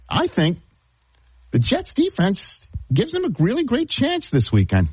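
very high-pitched sports take; high register. Pretend. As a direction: deliver the bold pick way up high. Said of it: "still gotta go high register".

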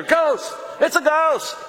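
high, loud cry of terror; panicked yell. Retold or imitated. Fishermen yelling to each other in the boat, terrified shout. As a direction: shout it out in fright at the shape on the water. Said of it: "yell out to each other".

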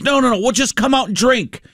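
high, pleading retold protest; coaxing buddy. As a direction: imitate a friend talking someone round. Acting buddy pleading to come out.

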